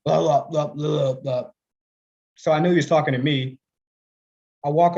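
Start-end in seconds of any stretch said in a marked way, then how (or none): 0.0-1.5 a hint of grandpa grumbling; mumbling old grandfather. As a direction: mumble it like an old man nobody can understand. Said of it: "I hear him go".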